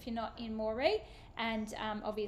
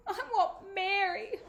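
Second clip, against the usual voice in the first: put-on tearful child; 9 semitones higher, 4 dB louder than usual. A narrator's reading. Crying little sister.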